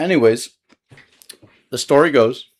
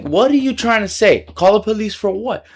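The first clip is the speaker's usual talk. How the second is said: high, loud incredulous questions; acted-out raised-voice disbelief; retold indignant challenge; 10 semitones higher, 6 dB louder than usual. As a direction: throw the threat back, pitched up. Challenging the threat.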